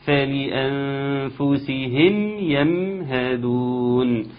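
فلأنفسهم يمهدون chanted slowly, both mims equal. Shown as a demonstration. Teacher giving the correct balanced reading, chanted. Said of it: "الاثنين ميزان متساوي".

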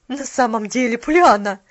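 loud reproving call; disapproving. A narrator's reading. Mrs Carew reproving Pollyanna, disapproving. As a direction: reproach her. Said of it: "с явным осуждением".

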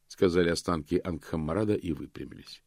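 ordinary delivery, nothing marked

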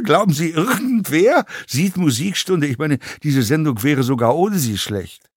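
animated, swooping scorn, building to incredulous questions; scornful fellow passenger. A narrator's reading. Passenger belittles the actor's show, scornful rant. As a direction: lecture him with mounting contempt.

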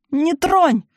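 high, loud shriek; desperate yell. A narrator's reading. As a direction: scream to warn her off. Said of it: "завопила Аксинья".

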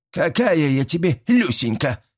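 put-on old woman teasing the dazed chairman; mocking mimicry. A narrator's reading. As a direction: mimic him back scornfully. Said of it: "передразнила Степанида".

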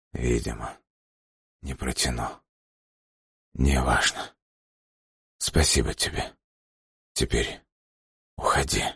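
faint whisper, barely voiced; a dying man's last words; weak hoarse whisper. A narrator's reading. Dying man speaks faintly.

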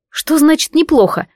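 high, startled question; surprised. A narrator's reading. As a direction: caught off guard and puzzled. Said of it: "удивилась я".

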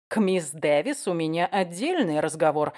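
ordinary delivery, nothing marked

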